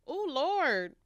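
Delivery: high, loud, breaking "oh, Lord"; overcome, voice cracking; choked up. Real, not acted. Guest is overcome answering the host about her younger self, voice cracking.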